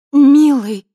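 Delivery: high, loud, cooing "darling". A narrator's reading. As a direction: coo it like a seductress. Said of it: "проворковала она".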